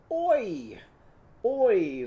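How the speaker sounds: high, loud "Oi! Oi!" like a squirting can; oil can sound. Shown as a demonstration. Teacher demos Joy's oil can sound, high and loud. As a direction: pop out "Oi!" twice, high and loud. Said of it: "move her thumbs up and down and go".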